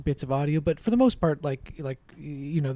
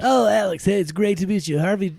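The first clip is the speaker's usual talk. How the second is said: movie star voice. Acted. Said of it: "That's my new movie star voice".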